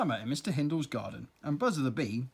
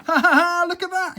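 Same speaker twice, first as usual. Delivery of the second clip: high, loud laugh into the words; chuckling; 12 dB louder than usual. Acted. Spider laughing at the bee carrying the earwig, high and loud. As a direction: laugh in delight. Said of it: "he chuckled".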